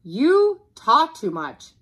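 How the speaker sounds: loud, slow, hammered-out complaint; father's yell. Acted. Father to the talkative dog, yelling. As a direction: yell it with every word drawn out. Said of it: "yelled Father".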